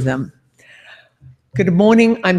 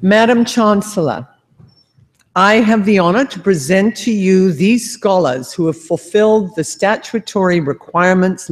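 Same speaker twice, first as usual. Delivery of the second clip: raised, stately ceremonial address; formal ceremonial speech; 3 semitones higher, 4 dB louder than usual. Acted. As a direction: proclaim it to the hall. Formal presentation of graduates.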